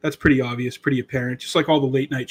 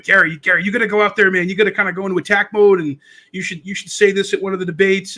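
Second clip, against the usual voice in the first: higher, louder, fast pep-talk urging; fired up; 5 semitones higher, 4 dB louder, faster than usual. Retold or imitated. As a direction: push him into attack mode, pumped. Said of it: "I was kinda fired up".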